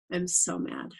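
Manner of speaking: softly stated anger, no heat; calmly mad. Retold or imitated. Showing why her husband didn't believe her, soft and calm. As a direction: say it quietly, as if perfectly untroubled. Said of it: "That's how I said it".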